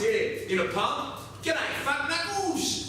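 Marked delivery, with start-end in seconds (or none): 1.4-2.8 high, mocking greeting; cheeky bloke. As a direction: taunt him with a high sing-song hello. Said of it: "I saw this bloke say to him once".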